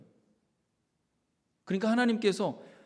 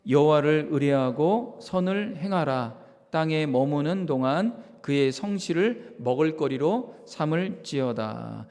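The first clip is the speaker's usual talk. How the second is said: louder, slow unison reading; congregational scripture reading; 8 dB louder, slower than usual. Real, not acted. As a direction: read the verse aloud together with the church. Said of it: "다 같이 한번 읽겠습니다". (다 함께 천천히 큰 소리로 봉독)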